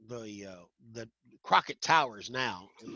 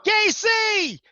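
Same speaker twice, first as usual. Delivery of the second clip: high, loud shout across a room; calling out; 13 dB louder than usual. Retold or imitated. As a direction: call his name out from far away.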